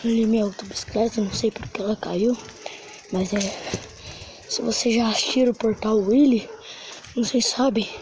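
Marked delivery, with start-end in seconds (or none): none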